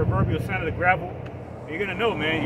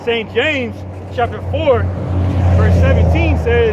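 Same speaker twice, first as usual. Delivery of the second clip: loud, slow, high preaching read of the verse; scripture reading; 8 semitones higher, 12 dB louder than usual. Acted. Preacher reading a verse to his friend, loud and proclaiming. Reads a Bible verse aloud.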